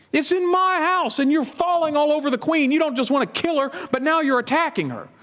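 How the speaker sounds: the king explodes at Haman in his own house; furious king. Acted.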